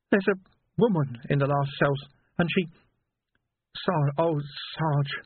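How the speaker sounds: a shaken lad stumbling over words; trembling young constable. A narrator's reading.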